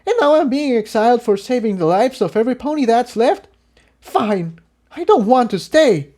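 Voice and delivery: put-on high, fast, hurt young voice; bitter outburst. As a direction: let the hurt spill out. Exiled pony's bitter outburst.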